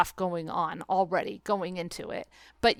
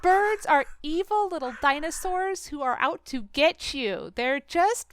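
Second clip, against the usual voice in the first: high, slow mock menace; hammed-up dread; 7 semitones higher, slower than usual. Host hamming up bird fear, high and slow. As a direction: play up the bird horror.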